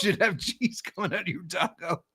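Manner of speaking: amused, laughing through it; teasing while giggling. Real, not acted. Teasing innuendo reply.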